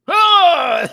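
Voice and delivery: loud, high mock shout; cartoon yell; imitated shout. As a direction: belt it out as the TV dad. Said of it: "he just said it so loud".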